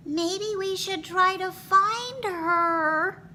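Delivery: put-on very high child voice; worried kids. A narrator's reading. Kids worrying about missing teacher, child voice. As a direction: suggest it hopefully as a child. Kids' voice in story.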